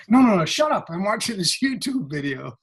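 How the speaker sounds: high, mock-irritated shushing; annoyed brush-off. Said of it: "we're up here going".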